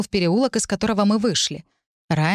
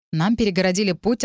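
usual speaking voice

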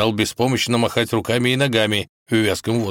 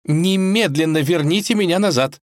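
high, indignant princess demand; imperious high-pitched princess; commanding; 9 semitones higher than usual. A narrator's reading. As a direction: order it angrily, pitched way up. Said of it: "приказала Василиса, топнув ногой".

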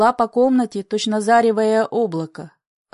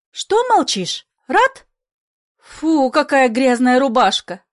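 commanding; 2 semitones higher, 4 dB louder than usual. A narrator's reading. Mother scolding her son over his dirty shirt, commanding. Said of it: "всё звучал командующий голос".

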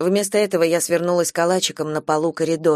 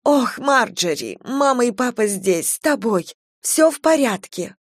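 soothing mother; 4 semitones higher than usual. A narrator's reading. Mother comforting daughter.

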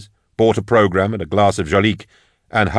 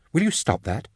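hushed, higher-pitched woman's complaint; complaining; 6 semitones higher, 6 dB quieter than usual. A narrator's reading. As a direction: scold a neighbour under your breath. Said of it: "complained the woman beside him".

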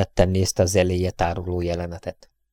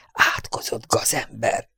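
slow, breathless, a little softer; panting curse; 3 semitones higher, 5 dB quieter, slower than usual. A narrator's reading. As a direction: gasp the insult out between breaths. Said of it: "lihegte Harryden".

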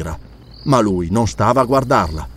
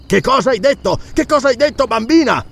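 high, shocked questioning; alarmed demand; 11 semitones higher than usual. A narrator's reading. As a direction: demand it of the child in disbelief. Alarmed count questions the girl.

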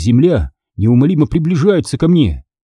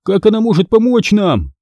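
high, anxious question; agitated; 8 semitones higher than usual. A narrator's reading. Boy doubting a card can help, agitated. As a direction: ask it keyed up. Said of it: "взволнованно спросил Конор".

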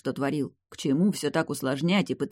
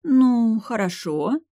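loud, higher-pitched easy concession; understanding shrug; 7 semitones higher, 5 dB louder, much slower than usual. A narrator's reading. Mother lets her son's question go, accepting shrug. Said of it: "пожала матушка плечами, понимая меня".

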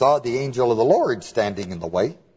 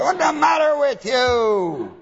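much higher, slow hammed-up yell; mock shout; 12 semitones higher, slower than usual. Balaam shouting at his donkey in mock fury. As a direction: bellow it at the stubborn donkey.

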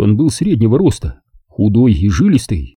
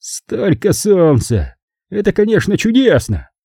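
slow start, higher-pitched mild grumble; grumbling; 9 semitones higher than usual. A narrator's reading. Count grumbles about California sun, mildly. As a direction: praise the sun with a touch of grumbling. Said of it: "проворчал он".

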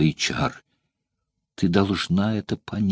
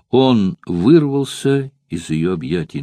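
ordinary delivery, nothing marked

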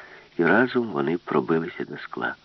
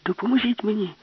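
pleading, much higher; urgent high plea; 13 semitones higher than usual. Acted. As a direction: beg him to help, pitched up. Alice begs for help.

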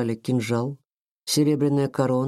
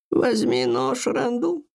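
ethereal voice; 10 semitones higher, slower than usual. A narrator's reading. Grandmother commands in ritual.

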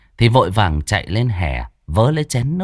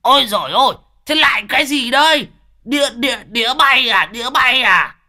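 shrieking. Acted. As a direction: scream in pain and confusion. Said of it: "rú lên". (rú lên hoảng loạn)

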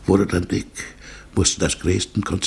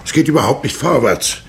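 impatient complaint; 7 semitones higher, 5 dB louder than usual. Acted. Carriage stalls, impatient complaint.

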